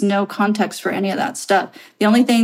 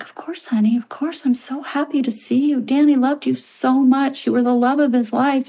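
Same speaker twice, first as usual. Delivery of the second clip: gushing mother; 6 semitones higher than usual. Retold or imitated. Grieving mom welcoming her.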